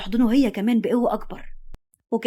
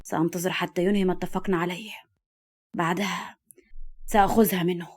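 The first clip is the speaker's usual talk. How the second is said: low, restrained malice; spiteful; 3 semitones lower, 4 dB quieter than usual. A narrator's reading. Villain planning to take her from him, spiteful. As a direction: quietly plot with a grudge. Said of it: "وقال بحقد".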